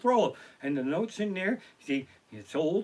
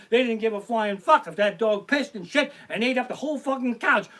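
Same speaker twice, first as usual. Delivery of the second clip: normal talking voice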